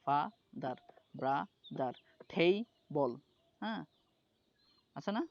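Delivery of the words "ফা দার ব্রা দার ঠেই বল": each of ফা, দার, ব্রা, দার, ঠেই, বল as its own beat; syllable by syllable. Shown as a demonstration. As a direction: chop ফা-দার, ব্রা-দার, ঠেই-বল into parts with pauses. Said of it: "ফাকে চাইলে আর short করতে পারবেন না".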